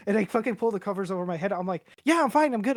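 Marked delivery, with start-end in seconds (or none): 2.0-2.8 high, loud insistence on being fine; overeager reassurance. Retold or imitated. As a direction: blurt it out too brightly to convince them. Insisting he is fine while tripping.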